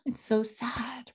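slow, soft whisper; whispered sadness. Shown as a demonstration. Parent modeling sadness for the kids, in a slow whisper. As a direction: breathe the words out sadly. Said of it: "demonstrating that emotional literacy".

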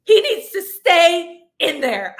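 high, loud, drawn-out wail; mock crying plea. Mother in labor refusing to push, mock wail. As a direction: plead tearfully, hammed up. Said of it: "I started crying".

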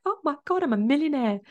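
high-pitched re-enacted delight; excited mock exclamation. Podcaster recalling her first big year, mock-excited. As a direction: act out giddy disbelief, pitched well up. Quoting own excited past reaction.

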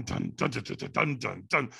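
low sung dun dun of drums; drumbeat imitation. Shown as a demonstration. Imitating the drummers for his host, low drum sounds. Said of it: "imitating African drummers".

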